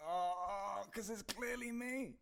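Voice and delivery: high, soft, slow mock realisation; small high voice, acting out the reaction; acted reaction. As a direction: squeak it quietly, drawn out. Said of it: "And I was like".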